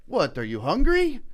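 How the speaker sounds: joking jibe. Retold or imitated. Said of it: "You know, joking about me".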